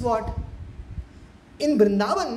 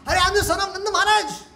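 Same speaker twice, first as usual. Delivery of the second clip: high, loud, rapid protest; puffed-up, high-pitched insistence; indignant boast; 11 semitones higher, 6 dB louder, faster than usual. Acted. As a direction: blurt it out, loud and offended. Krishna boasting in acted quarrel.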